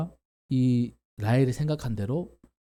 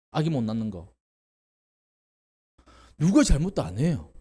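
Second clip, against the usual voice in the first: normal talking voice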